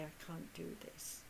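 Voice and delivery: very soft, low inner voice; quietly voiced inner refusal. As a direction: hush it like a voice deep inside.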